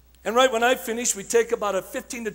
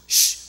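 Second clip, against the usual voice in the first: loud shush; 10 dB louder than usual. Shown as a demonstration. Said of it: "You just go".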